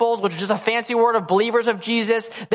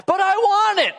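put-on whiny toddler; 9 semitones higher than usual. Toddler whining at dad.

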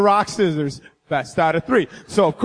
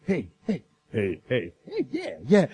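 lightly voiced smooth talker; 10 semitones lower, 7 dB quieter than usual. Rehearsing low smooth greeting.

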